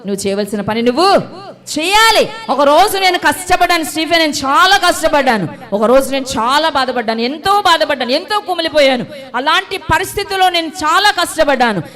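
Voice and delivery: loud, high testimony cry; full-force preaching. Acted. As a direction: shout it out, pouring out her hardship. Preaching her own testimony. (గట్టిగా సాక్ష్యం చెప్పే స్వరం)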